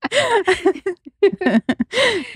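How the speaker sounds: long, very high-pitched laugh; high squealing laugh. Real, not acted. Cracking up with the co-host over D T P, high squealing laugh.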